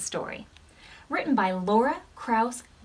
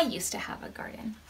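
whisper; 9 dB quieter than usual. Real, not acted. Aside to the kids.

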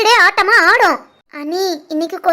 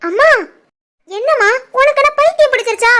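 higher, shouted disbelief; shocked outrage; 5 semitones higher than usual. Acted. Daughter slapped by her mother, outraged shout. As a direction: cry out stunned and hurt.

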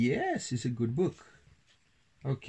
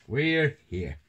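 slightly raised, higher character voice; Toby crying out; 6 semitones higher, 5 dB louder than usual. A narrator's reading. Town mouse arriving in town, mild excited cry. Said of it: "cried Toby".

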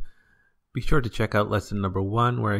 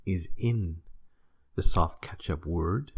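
ordinary talk

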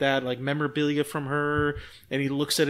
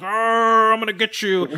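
hammed-up Bigfoot roar, higher and louder; mock monster growl; 7 semitones higher, 7 dB louder than usual. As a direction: lightly growl like a cartoon monster. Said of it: "Bigfoot's like".

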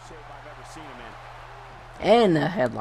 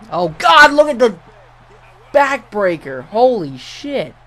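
excited; 6 semitones higher, 8 dB louder than usual. Real, not acted. Stunned by a backbreaker.

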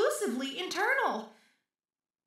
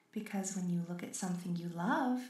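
ordinary delivery

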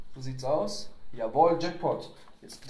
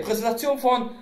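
hype announcement; 8 semitones higher, 9 dB louder than usual. Acted. Hyped introduction of brother's product.

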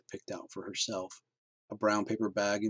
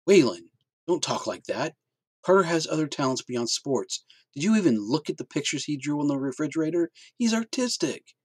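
higher, louder protest for her son; pleading mother; 7 semitones higher, 6 dB louder than usual. Acted. Mother defending her son to her husband, higher and pleading. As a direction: stand up for the boy. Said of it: "Michelle interjected".

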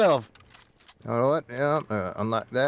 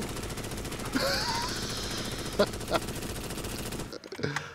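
high wild laugh; 13 dB quieter than usual. Real, not acted. Laughing after chaos.

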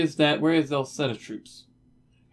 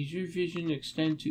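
ordinary talk

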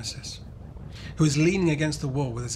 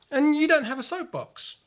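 put-on much higher boy's voice, matter-of-fact; a friend gently noting the problem; pointing out; 13 semitones higher, 3 dB louder than usual. A narrator's reading. Lewis reminds Kimmy the race is tomorrow, matter-of-fact. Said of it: "Lewis points out".

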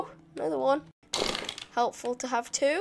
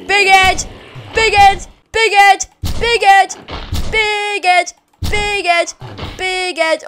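loud, high repeated jeer; taunting shout; 6 semitones higher, 11 dB louder than usual. Pretend. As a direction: chant it at the enemy with each blow. Taunts enemies while hitting.

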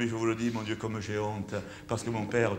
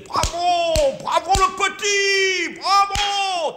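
imitating a father yelling bravo from the stalls, much higher and louder; high, drawn-out cheering bravo; proud shout; 7 dB louder, much slower than usual. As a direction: call it out like a proud dad in the audience. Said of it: "à la fin il criait".

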